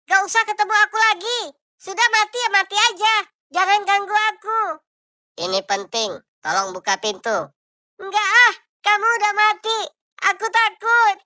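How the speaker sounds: high, loud frightened pleading; scared wife. Acted. Wife refusing her dead husband's ghost, high frightened voice. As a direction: push the ghost away in a high panic.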